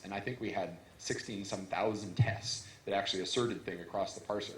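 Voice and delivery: normal talking voice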